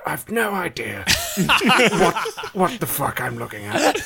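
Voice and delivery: lightly voiced hushed nature narrator; a hint of documentary presenter; David Attenborough. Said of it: "David Attenborough was like".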